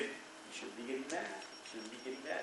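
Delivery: hushed, low repeated rebuke; gentle quiet warning, barely voiced. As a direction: say it softly, like a voice inside. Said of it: "The Lord saying".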